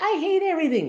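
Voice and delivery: put-on high, whiny child voice; sulky child's complaint. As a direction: mimic a kid's tantrum.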